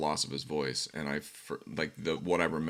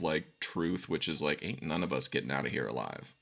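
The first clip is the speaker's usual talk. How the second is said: normal talking voice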